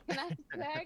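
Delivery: southern twang. Shown as a demonstration. Player jokingly redoing the question in a southern twang. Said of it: "I almost said it in a southern twang".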